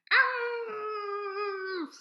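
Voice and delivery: long high loud wail; dog wail. A narrator's reading. Dog wailing at the preacher, loud high wail. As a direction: wail like a dog left outside. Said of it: "wailed Winn-Dixie".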